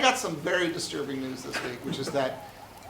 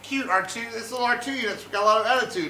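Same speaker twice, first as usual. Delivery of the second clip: condescending adult; 6 semitones higher, 4 dB louder, faster than usual. Retold or imitated. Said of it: "everyone's like".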